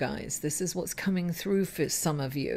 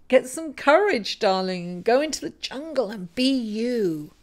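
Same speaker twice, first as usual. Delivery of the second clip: ordinary delivery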